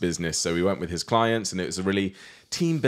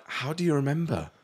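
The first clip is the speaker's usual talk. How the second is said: higher-pitched mock disbelief; retold incredulity; 8 semitones higher than usual. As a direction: act out being baffled. Retelling own incredulous reply to partner.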